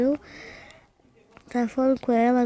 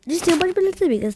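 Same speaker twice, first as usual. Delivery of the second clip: excited; 8 semitones higher, 5 dB louder, faster than usual. Real, not acted. Excited claim to viewers.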